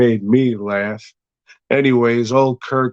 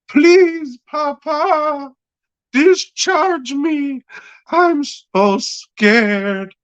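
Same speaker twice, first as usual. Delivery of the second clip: much higher, very slow whimpering plea; mock scared begging; 3 dB louder, much slower than usual. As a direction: whine like a terrified child to daddy. Cowardly son begging his father.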